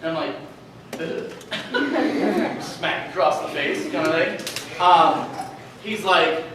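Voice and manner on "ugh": pained groan. Retold or imitated. Reacting to dad's blunt words.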